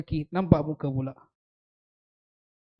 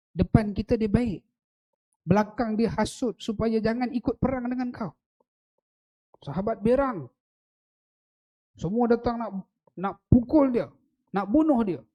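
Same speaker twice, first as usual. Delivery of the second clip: higher, louder preaching as the companions rage; rousing preaching; 6 semitones higher, 5 dB louder than usual. Acted. Preacher recounts the angry companions to the crowd, raised and urgent. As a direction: push it up with rising heat.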